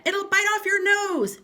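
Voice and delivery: mock alarm. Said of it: "Mister Twit said".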